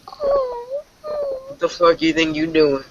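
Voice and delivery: incredulous outrage. Real, not acted. Reacting to a caller's noises, stretched-out outraged cry. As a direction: stretch it out in shocked disbelief. Caller moaning on the live stream.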